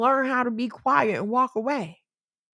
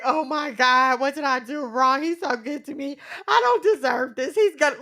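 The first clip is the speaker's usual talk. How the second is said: meltdown; 4 semitones higher than usual. Retold or imitated. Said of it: "I'm total meltdown".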